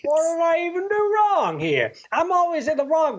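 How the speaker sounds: high-pitched, exaggerated aggrieved whine; mock indignant complaint. Imitating the husband after she walks away, high mock indignation. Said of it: "and he's like".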